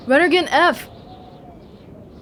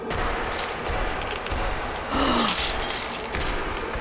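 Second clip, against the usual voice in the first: hushed gasp; 10 dB quieter than usual. Real, not acted. Sees enemies, hushed gasp.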